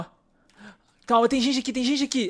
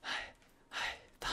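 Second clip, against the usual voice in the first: whisper; 19 dB quieter, slower than usual. Real, not acted. Hiding from zombies, hushed.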